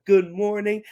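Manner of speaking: usual speaking voice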